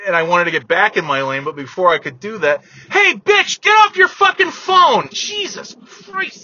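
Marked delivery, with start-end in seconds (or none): 2.9-5.1 yelling at driver. Real, not acted. 5.1-6.4 exasperated groan. Real, not acted. Exasperated after yelling.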